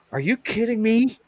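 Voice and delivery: high, incredulous question climbing at the end; retold disbelief. Telling friends about the woman's pink vest, incredulous. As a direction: act out being taken aback.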